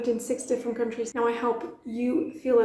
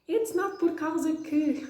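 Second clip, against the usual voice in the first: put-on kids correcting; 5 semitones higher than usual. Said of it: "you had a choir of other kids saying".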